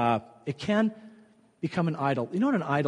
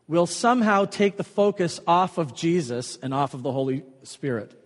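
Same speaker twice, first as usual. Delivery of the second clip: usual speaking voice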